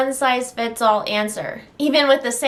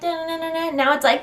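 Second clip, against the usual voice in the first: tongue flapping sound; 7 semitones higher, much slower than usual. Shown as a demonstration. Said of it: "a little bit of lispy stuff".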